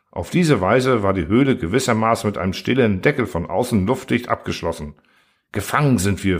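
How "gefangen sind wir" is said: ordinary delivery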